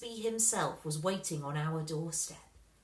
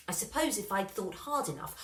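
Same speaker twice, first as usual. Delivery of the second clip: ordinary delivery, nothing marked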